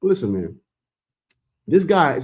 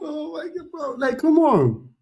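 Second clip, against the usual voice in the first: ordinary talk, nothing marked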